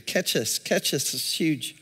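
usual speaking voice

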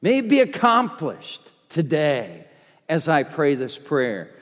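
ordinary talk, nothing marked

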